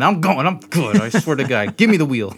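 loud hammed-up drunk bravado; mock drunk insistence. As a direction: insist you're fine, too loud.

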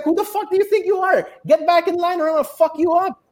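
high-pitched, rapid-fire retold outburst; fuming retort. Retelling his comeback to a line cutter, fuming. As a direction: reenact losing your temper, fast and high. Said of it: "I'm fuming".

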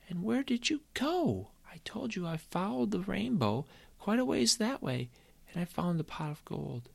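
put-on high child's voice going hushed; boy repeating his story to mom; lightly voiced insistent boy.

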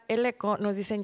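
usual speaking voice

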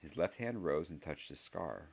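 plain reading voice

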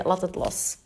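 a soft murmured letting go to herself; half-whispered, resigned and low; inner thought, quiet. Retold or imitated. Telling herself to drop it, softly. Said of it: "Ik denk, oké".

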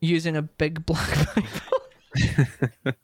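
slow, giggling through the words; laughing while reading. Real, not acted. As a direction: struggle to read through laughter.

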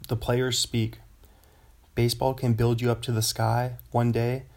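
ordinary talk

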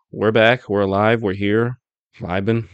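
normal talking voice

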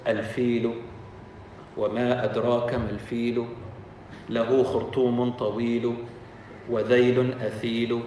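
low, soft, measured imitation of recitation; mock scripture recitation. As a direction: intone a false prophet's rhymed verse solemnly. False prophet recites invented surah.

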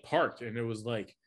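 usual speaking voice

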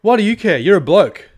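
high, loud mocking scoff; dismissive scoff. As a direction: scoff at him like he's clueless. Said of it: "And she's like".

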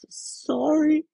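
high, broken whispered apology; tearful stammered sorry; sobbing whisper. A narrator's reading. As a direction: choke it out through tears. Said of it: "sobbed Hagrid".

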